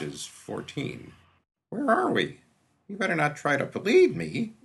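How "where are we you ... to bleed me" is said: put-on a high, wary boy's voice, on guard; a scared runaway snapping back, higher; frightened street kid. Boy wakes frightened, suspicious.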